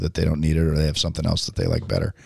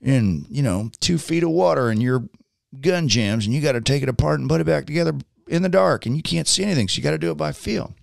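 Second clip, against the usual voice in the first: imitated Southern veteran, pitched up; thick Southern accent; 8 semitones higher than usual. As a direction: explain it in a Southern twang. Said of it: "it's this very thick Southern accent".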